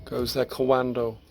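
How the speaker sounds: ordinary talk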